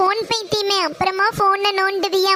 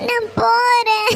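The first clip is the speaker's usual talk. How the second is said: crying; 3 semitones higher, slower than usual. Acted. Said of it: "இப்படி அழுகுற".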